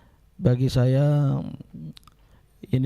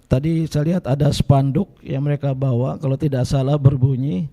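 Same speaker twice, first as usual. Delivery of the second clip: ordinary talk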